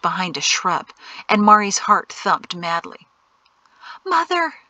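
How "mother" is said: much higher-pitched cry to mother; urgent call. A narrator's reading. Frightened daughter calls as danger nears.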